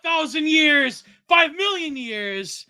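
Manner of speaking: high, loud hammed-up threat; mock threat. Co-host playfully sentencing someone to the crystal, loud mock threat. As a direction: proclaim it like an over-the-top doom sentence.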